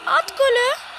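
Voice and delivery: tiz ve yüksek tezahürat; golü zorlayarak coş; heyecanlı bağırış. Real, not acted. Gol için heyecanla bağırıyor. (gol fırsatında heyecanla bağırıyor)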